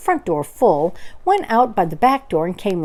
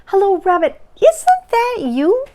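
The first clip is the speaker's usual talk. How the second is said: much higher, slow and drawn out; bear calling hopefully; 13 semitones higher, 6 dB louder than usual. Acted. Pooh calling down the hole to Rabbit, high drawn out. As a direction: sing it down the hole.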